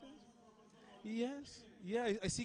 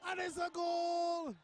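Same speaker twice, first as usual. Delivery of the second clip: excited Bajan commentator shouting, much higher; Bajan accent; 9 semitones higher than usual. Pretend. Commentator calling the winning header in a Bajan accent. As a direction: bellow the goal call in a thick Bajan accent. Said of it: "In my best Bajan dialect".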